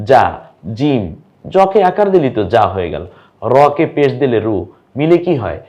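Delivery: ordinary delivery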